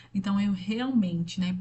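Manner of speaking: ordinary talk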